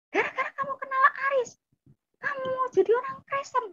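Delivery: high, fast, wailing reproach; upset accusation. Retold or imitated. Older sibling blames friend for the conversion, high rushed wail. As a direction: accuse in a rush, near tears.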